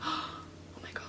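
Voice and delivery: panicked whisper; hushed horrified whisper. Retold or imitated. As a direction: breathe it out in shock. Whispered panic on seeing mom.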